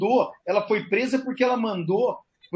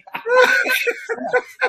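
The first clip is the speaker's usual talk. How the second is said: burst of laughter; 5 dB louder than usual. Real, not acted. Laughs off the audio glitch.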